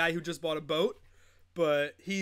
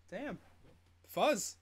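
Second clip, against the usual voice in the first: normal talking voice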